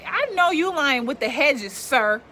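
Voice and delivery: loud, high call across the yard to the neighbor. Real, not acted. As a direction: shout out at the man by the hedges. Yelling at noisy neighbor.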